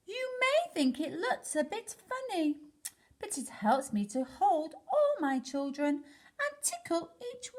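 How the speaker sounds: high, sweet octopus mother; high motherly octopus; octopus mum. Acted. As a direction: coo it like a fond octopus mum.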